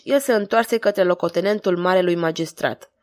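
ordinary delivery

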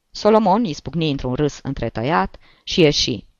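usual speaking voice

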